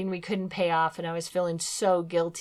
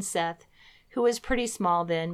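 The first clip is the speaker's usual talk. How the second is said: ordinary talk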